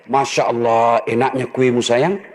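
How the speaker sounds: low, sugary flattering husband; mock-tender praise for the cake; fake-sweet husband praise.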